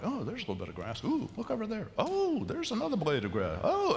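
hushed, silly wonder; distracted sheep. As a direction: play a sheep drawn from tuft to tuft. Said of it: "They're like".

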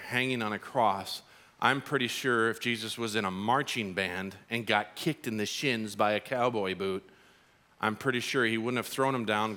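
ordinary delivery

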